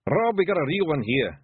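mild pictsie holler; calling out. A narrator's reading. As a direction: call over to the chief. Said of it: "a pixie called out".

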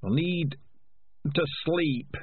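put-on high, weary old rat; a tired old leader fading; frail old rat. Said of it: "said Dark Tan".

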